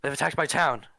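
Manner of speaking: high, loud, terrified cry of alarm; panicked warning. Acted. Wounded villager warns the party about pirates, terrified shouting. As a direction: scream the warning like your town is burning. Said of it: "He goes".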